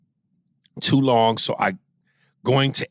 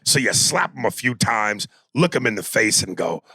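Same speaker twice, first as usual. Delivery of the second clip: ordinary talk, nothing marked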